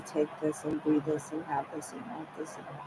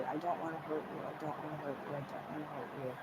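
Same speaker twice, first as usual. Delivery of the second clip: repeated plea that drops softer and softer each time; fading plea; 12 dB quieter, much faster than usual. Retold or imitated. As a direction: let it fade to nearly nothing.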